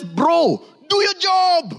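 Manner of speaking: high shouted order; God ordering the believer, shouted; firm command. Retold or imitated. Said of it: "God looks at us and says".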